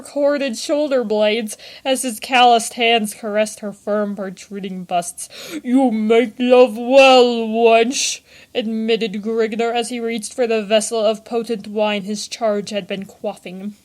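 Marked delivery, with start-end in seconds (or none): 5.6-8.2 slow, louder, heavy barbarian voice; lordly barbarian. A narrator's reading. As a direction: savour it slowly like a satisfied warrior. Barbarian speaks to the wench.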